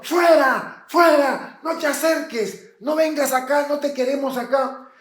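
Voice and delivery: high, loud shouts of rejection; gente rechazándolo. Acted. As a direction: drive him away, shouting as an alarmed crowd. Crowd drives the leper away. (gritos agudos de rechazo)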